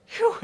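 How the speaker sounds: a high, winded exhale; worn-out puff of breath; breathless phew. Real, not acted. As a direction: blow out air after exertion. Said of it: "I apologize if I'm out of breath".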